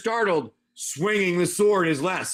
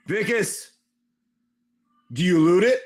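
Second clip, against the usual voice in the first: usual speaking voice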